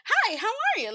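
bright squeaky greeting; chirpy hi. Retold or imitated. Showing her unsexy flirting, high and loud. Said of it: "I don't know how to be sexy".

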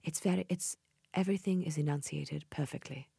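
soft, posh British RP; posh British accent. Shown as a demonstration. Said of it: "What does that sound like?".

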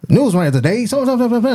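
put-on high, old-timey newsreel announcer voice. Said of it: "they talk like that".

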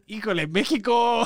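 high, put-on voice for Híjole, México; mock Mexican exclamation. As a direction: play the shocked Mexican onlooker, high-pitched.

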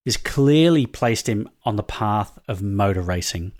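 scripted reveal read. Acted. Reads scripted mystery-guest bio.

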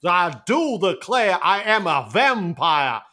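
slow, hammed-up Southern vampire; bad Southern accent. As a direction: parody the actor's Southern drawl slowly. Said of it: "he manages to be worse than the guy".

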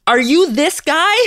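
high, drawn-out, incredulous question; excited imitated stranger. Woman runs up to Jimmy with her phone, excited retold question. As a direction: imitate an excited passer-by, pitch jumping up.

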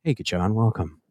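calm radio host greeting a caller. Shown as a demonstration. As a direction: soothe the caller in gently. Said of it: "This is my calm voice".

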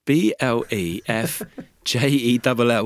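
slow letter by letter spelling. Shown as a demonstration. Spelling the name for his co-host, slow. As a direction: spell B L E F J E L L out slowly. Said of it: "How did you say it?".

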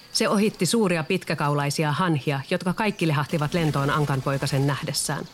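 usual speaking voice

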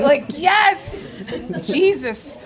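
high, loud comic rejoicing; mock elation. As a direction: exult as if prayers were answered. Acting out elation at PT school.

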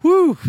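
a high, loud whistle of a whew; relieved whew. Retold or imitated. Retelling the Prince standoff, a high loud relieved whew. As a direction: blow out the tension like you just survived.

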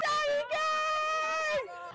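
high, drawn-out call to the fans. Real, not acted. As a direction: stretch it out like a cheer to the crowd. Calling out to fans.

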